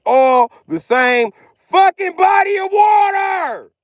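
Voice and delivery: slow exasperated yell; frustrated shout. Real, not acted. Ranting about rivers connecting.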